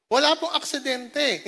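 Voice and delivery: ordinary delivery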